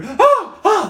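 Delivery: high, loud gasping shriek; short shrieks of shock from a crowd; startled shrieks. Acted. Bystanders see people suddenly appear, startled shrieks. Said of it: "There's a few shrieks".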